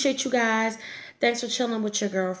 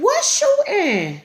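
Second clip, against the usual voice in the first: drawn-out, much higher and louder; surprised; 12 semitones higher, 6 dB louder, much slower than usual. Retold or imitated. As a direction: stretch it out in shock. Surprised reply to boyfriend.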